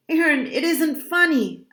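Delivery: high, protesting cry; urgent, high-pitched protest; upset cry. A narrator's reading. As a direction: cry it out, winded and distressed. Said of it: "I cried".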